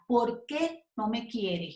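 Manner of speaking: POR QUÉ slow with stress on QUÉ, brief break after; separated "por qué". Shown as a demonstration. Teacher letting students feel the separation when speaking, separated question. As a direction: set QUÉ apart before "no me quieres". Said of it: "la separación al hablar".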